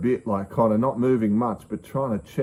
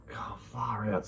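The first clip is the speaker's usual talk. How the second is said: frustrated mutter; 10 dB quieter, slower than usual. Retold or imitated. Frustrated while waiting for effects.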